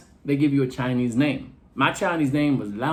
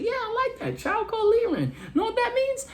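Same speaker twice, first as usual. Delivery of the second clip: mock-proud voice pitched way up; high squeaky delight. Comic telling himself, pleased with the name, in a squeaky high voice. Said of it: "I was like".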